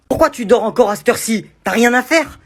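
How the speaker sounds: loud, annoyed mother; angry mum. Acted. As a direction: yell at the lazy kid. Mum yelling at son.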